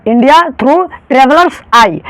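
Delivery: each word of India through travelers eye drawn out slowly; slow, spelled-out title. Shown as a demonstration. Teacher slowly spelling out the title for students. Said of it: "तो यहाँ पर क्या हो रहा है".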